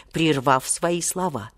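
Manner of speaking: plain reading voice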